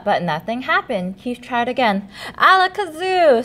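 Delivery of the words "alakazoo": high, loud grand incantation; higher, louder spell; magic spell. Acted. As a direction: call out the spell with flourish. Keith tries again.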